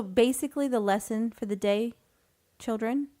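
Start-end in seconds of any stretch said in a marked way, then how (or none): none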